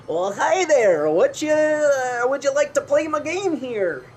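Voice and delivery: high, slow folksy welcome; clown game host. Acted. Fisherman clown inviting players to her game, high drawling welcome. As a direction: greet them high and drawn out, like a carnival hawker. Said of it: "And she's like".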